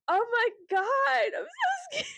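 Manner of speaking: high, slow wail breaking into laughter; mock crying. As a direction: bawl it melodramatically, half laughing. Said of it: "crying in the bathroom".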